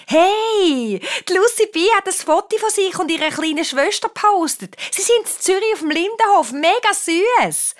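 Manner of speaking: put-on high, gushing squeal of a girl; excited calling out. A narrator's reading. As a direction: announce gossip to the whole room, high-pitched. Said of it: "ruft eine von der Horror-Heidis". (begeistert und hoch ausrufen)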